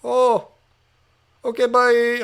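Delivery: imitating a caller's Indian accent, high-voiced. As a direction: put on an Indian call-centre accent. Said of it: "I'm doing an Indian accent".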